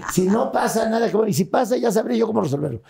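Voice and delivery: high-pitched retold insistence; son insisting. Son insisting he will handle it.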